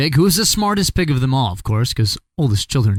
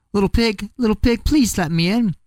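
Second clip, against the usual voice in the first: coaxing wolf; 8 semitones higher than usual. Acted. Wolf begs pig again.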